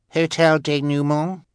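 put-on baby voice. A narrator's reading. Baby Sunny's voice.

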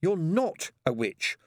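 put-on high, exasperated girl; high-pitched pleading explanation; desperate. A narrator's reading. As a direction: reason urgently with a stubborn little sister. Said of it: "said Pepper desperately".

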